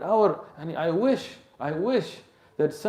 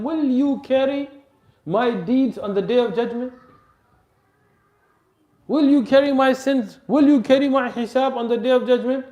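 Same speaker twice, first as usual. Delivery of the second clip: louder and higher, building with each question; impassioned rebuke; 7 semitones higher, 4 dB louder than usual. Acted. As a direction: press each question harder. Umar rebukes servant, preacher intensifies.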